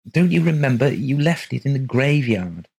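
exasperated woman's voice. A narrator's reading. Wife answers Colgate.

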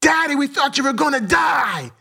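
very high-pitched imitated cry of alarm; daughter's retold scream. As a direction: scream it like a frightened daughter, without much extra volume. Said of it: "My daughter screams".

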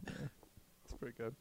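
slow whispered approval. Real, not acted. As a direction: breathe it out as a whisper. Whispered verdict after the chant.

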